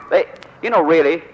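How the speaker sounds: usual speaking voice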